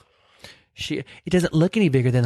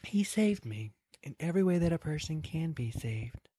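put-on hushed old Rose; 11 dB quieter than usual. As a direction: let it fall quiet, like a memory. Quoting old Rose from Titanic.

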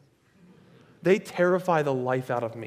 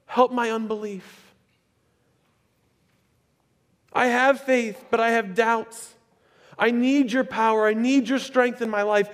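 much higher, louder pleading cry; desperate father crying out; 8 semitones higher, 5 dB louder than usual. Acted. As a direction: beg Jesus like a father at the end of his rope. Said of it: "The father cried out".

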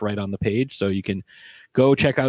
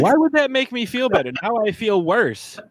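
mock offended; 12 semitones higher, 4 dB louder than usual. Mock offense at friend's remark.

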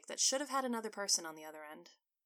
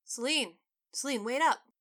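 calling out; 5 semitones higher, 7 dB louder than usual. Acted. Calling after his departing wife.

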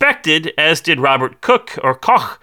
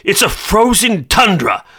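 epic narration; 8 semitones higher than usual. Acted. Mock-epic synopsis opening.

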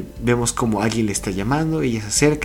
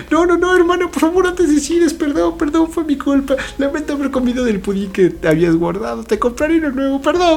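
put-on very high, louder pleading kid-sister voice; much higher, louder tearful little sister apologizing; frantic little sister; 4 dB louder than usual. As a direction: squeal it like a guilty little girl begging her sister not to jump. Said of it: "su hermana dice".

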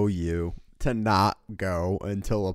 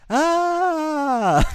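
retold slide scream; 7 dB louder than usual. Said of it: "going down the slide going".

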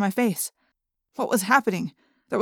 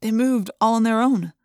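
usual speaking voice

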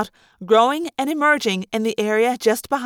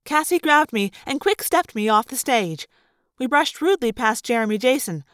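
plain reading voice

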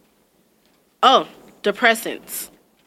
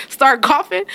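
ordinary delivery

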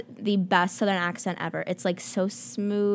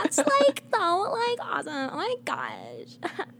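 put-on Valley Girl; 9 semitones higher, 4 dB quieter, slower than usual. Co-host topping the accent demo, exaggerated Valley Girl. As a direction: start very high, then drop and drag it out, Valley Girl style. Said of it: "like, the Valley Girl".

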